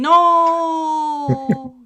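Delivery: loud dramatic wail of no; mock cry. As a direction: yell it like falling. Pretending to fall into pit.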